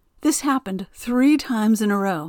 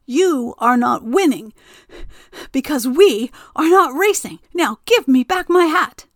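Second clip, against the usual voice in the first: put-on scolding old woman; 6 semitones higher, 6 dB louder than usual. A narrator's reading.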